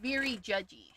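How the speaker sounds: quiet aside repeat of Very judgy; quiet echo. Shown as a demonstration. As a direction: drop the voice on the repeat. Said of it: "repeats it quieter".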